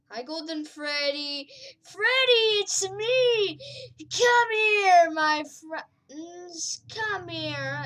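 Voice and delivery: loud, high, happy calling out; yelling a friend's name with joy. Real, not acted. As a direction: shout across the map to a friend. Calling out loudly to a friend in game.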